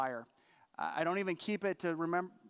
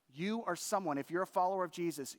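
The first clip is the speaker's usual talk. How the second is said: ordinary talk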